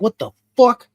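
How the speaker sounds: high, loud startled outburst; sudden disbelieving cry; retold shock. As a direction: jolt up in alarm at the sight. Shock at his bleeding hands.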